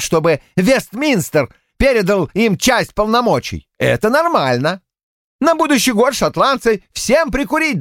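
high, fervent preaching voice; heated patriotic rant. A narrator's reading. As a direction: hammer home each point. Polward patriotic harangue.

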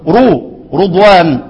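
رو، رضوان with a rounded "ru" on the first syllable, slowed; slow damma demo on رضوان. Shown as a demonstration. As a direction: say رضوان as "rudwan", stretching the "ru". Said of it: "بالضم".

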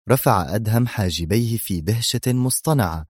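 ordinary delivery, nothing marked

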